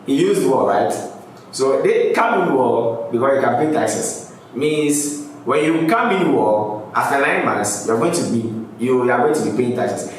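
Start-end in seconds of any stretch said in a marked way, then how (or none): none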